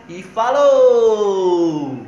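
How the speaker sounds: long loud "falou" yelled out; drawn-out sign-off. Acted. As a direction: stretch the goodbye out loud.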